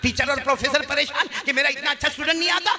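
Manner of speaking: high, impassioned pulpit delivery; full-force preaching. Real, not acted. Preacher telling congregation of a worried teacher, impassioned. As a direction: build in pitch through the list.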